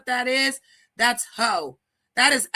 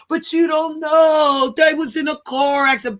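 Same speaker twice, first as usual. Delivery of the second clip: mock whiny excuse; 7 semitones higher, 7 dB louder than usual. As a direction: ham up a defensive excuse-maker, shouting. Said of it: "when they be like".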